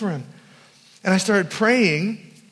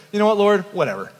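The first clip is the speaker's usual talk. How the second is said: dismissive whatever; faster than usual. Retold or imitated. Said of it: "See my terrible attitude".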